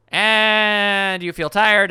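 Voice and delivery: very slow, drawn-out lament; stretched, theatrical complaint; mock misery. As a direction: drag out every word wearily. Acting out misery of studying.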